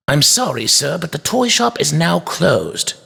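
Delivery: high toy maker's voice, mildly curt; short, curt. A narrator's reading. Toy maker turns away late customer, short. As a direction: dismiss him politely but shortly. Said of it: "the toy maker said shortly".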